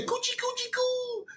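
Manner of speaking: high, soft coochie-coo; baby talk. Retold or imitated. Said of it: "how you say".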